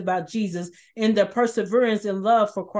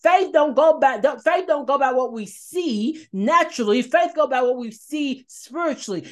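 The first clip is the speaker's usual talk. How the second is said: preaching; 4 semitones higher than usual. Acted. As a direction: preach it with rising fervor to the congregation.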